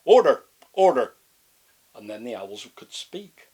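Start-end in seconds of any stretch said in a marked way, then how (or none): 0.0-1.1 loud, harsh raven call; loud raven shouting order. Acted. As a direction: bark order like a raven chairing. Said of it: "he went".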